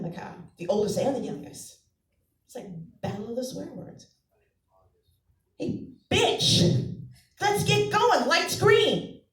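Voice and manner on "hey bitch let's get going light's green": put-on high, loud bossy little girl; mock child voice, much higher and louder; sassy kid. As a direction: boss the driver like a tough kid. Kid swearing in traffic.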